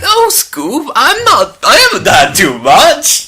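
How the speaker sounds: high, loud goofy cartoon voice; Shaggy voice. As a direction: whine it like a dopey cartoon slacker. Joking Shaggy banter.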